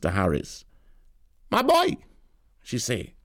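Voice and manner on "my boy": put-on old auntie voice. A narrator's reading. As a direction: play the pushy old neighbour at full pitch. Tanti reaches Harris.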